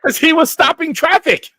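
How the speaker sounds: high, loud comic outburst; mock yelling. Host cuts into the parking-lot story, mock yelling. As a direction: shout it way up high.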